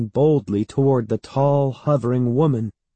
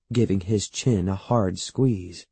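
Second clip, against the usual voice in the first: ordinary delivery, nothing marked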